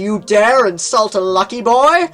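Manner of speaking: loud high indignant cry; outraged. Retold or imitated. Boyfriend defending his doll, loud outrage. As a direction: bellow the insult back at her. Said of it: "he's like".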